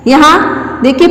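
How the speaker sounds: normal talking voice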